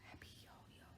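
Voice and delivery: breathless hushed whisper of the name. A narrator's reading. Storyteller whispers the giant's name.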